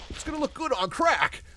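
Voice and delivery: high, loud silly squeal; goofy joke voice. Acted. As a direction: blurt the joke in a cartoon voice. Silly joke punchline, then retracted.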